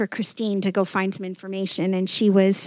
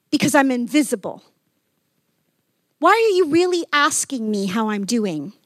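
angry challenge; 6 semitones higher, 4 dB louder than usual. Retold or imitated. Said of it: "so angry".

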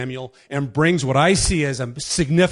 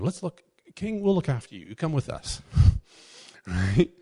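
usual speaking voice

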